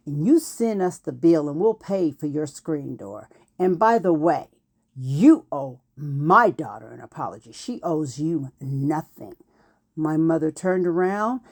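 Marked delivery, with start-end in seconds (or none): 0.1-9.4 low, firm, emphatic retelling; controlled indignation, pitched down; stern final word. Retold or imitated. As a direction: voice a mother having the last word. Mother gives neighbor final word.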